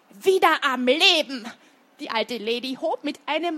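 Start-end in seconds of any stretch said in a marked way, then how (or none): 0.1-1.5 loud, forceful retort; outraged old lady's loud declaration; indignant exclamation. Acted. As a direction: cut in loudly and indignantly. Old lady angrily completes his sentence.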